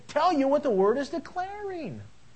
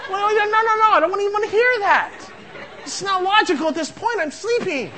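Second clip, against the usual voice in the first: mock loud protest; 8 semitones higher, 7 dB louder than usual. Half-asleep husband's retort.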